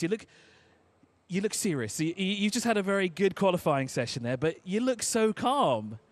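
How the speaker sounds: ordinary delivery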